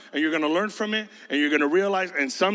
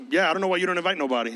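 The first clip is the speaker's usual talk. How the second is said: usual speaking voice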